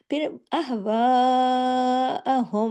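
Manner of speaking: अहवाअहुम drawn out slowly, the वा stretched long; long madd. Shown as a demonstration. Teacher showing the madd, अहवाअहुम stretched slow. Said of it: "इसको खींचना है".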